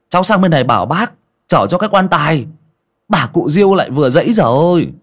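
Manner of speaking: high, fast baffled youth protesting; confused young man. A narrator's reading. Young neighbour protesting confusion to the old man, fast baffled. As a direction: rattle it off, bewildered.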